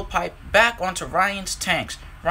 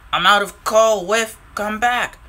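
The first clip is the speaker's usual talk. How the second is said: calling out; 4 semitones higher, 6 dB louder than usual. Acted. Scruff stranded, calls Whiff back.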